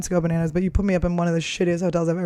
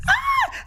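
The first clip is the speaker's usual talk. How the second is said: put-on high shrill kid's shriek of delight; excited child scream. Imitating the niece's surprised scream at seeing her aunt, squealing. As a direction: squeal like a thrilled little girl. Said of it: "she went".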